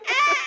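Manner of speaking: high, loud mock bleat; angry sea goat cry. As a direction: scream like a furious little goat, playfully. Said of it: "angry sea goat over here going".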